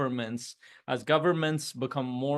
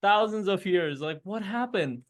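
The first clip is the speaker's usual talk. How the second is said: mock crying; 7 semitones higher than usual. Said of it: "he's still crying".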